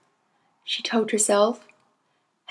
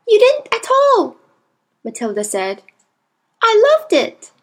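put-on high, louder reassuring child; young girl voice; 10 semitones higher, 5 dB louder than usual. A narrator's reading. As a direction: insist kindly like a girl. Matilda voiced.

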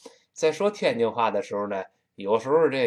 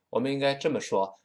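ordinary talk

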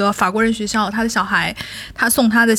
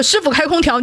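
raised, insistent call to the driver; asking the taxi driver for air conditioning, insistent; higher request; 7 semitones higher than usual. Retold or imitated. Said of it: "我的声音越高".

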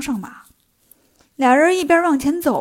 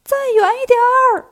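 high, loud shout from far off; shouting from afar; 11 semitones higher, 5 dB louder than usual. Acted. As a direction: call out from a long way away. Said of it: "又听见孙悟空喊". (远远地高声喊)

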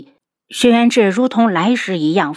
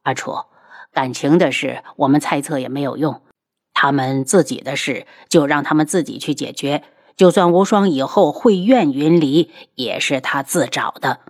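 soothing husband; 4 semitones lower than usual. Acted. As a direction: gently talk her down. Voices the husband calming his wife. (低声安抚妻子)

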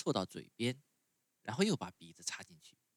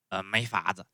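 grumbling rabbits; 7 dB louder than usual. A narrator's reading.

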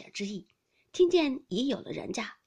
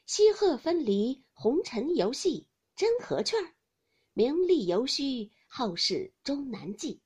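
slow, measured recital of verse; verse recitation; 3 semitones higher, slower than usual. A narrator's reading. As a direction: recite it slowly, drawing out each phrase. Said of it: "说着，便念道". (缓慢吟诵词句)

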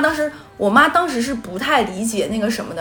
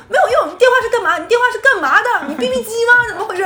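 fast, high, loud rant like rapping; angry mother berating son on speakerphone; rapid scolding; 12 semitones higher, 5 dB louder, faster than usual. Retold or imitated. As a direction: fire it off like a Northeastern auntie. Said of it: "然后我妈大骂".

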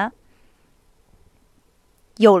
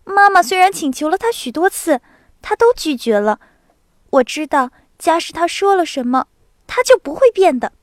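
激动的少女; 6 semitones higher, 5 dB louder than usual. A narrator's reading. 娜达莎的声音.

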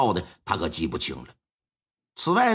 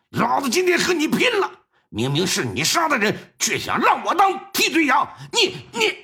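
a framed man raging at his accuser; furious shout. Acted. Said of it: "是怒火中烧啊，". (怒火中烧地大骂)

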